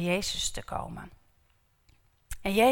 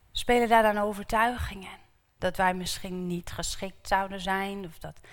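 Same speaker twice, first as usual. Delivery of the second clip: normal talking voice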